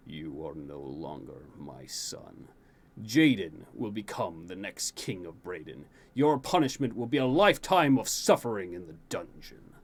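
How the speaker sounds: disown him with icy weight; stern king. A narrator's reading. King scolding his son, low cold anger.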